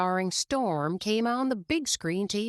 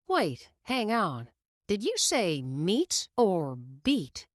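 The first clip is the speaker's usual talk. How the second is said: slow double take; slower than usual. Acted. Lightning catches the insult slowly.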